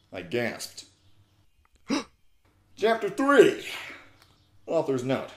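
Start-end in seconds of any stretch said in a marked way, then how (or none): 1.9-3.6 high, loud gasping announcement; gasp, then shout it out slowly; announced gasp. Acted.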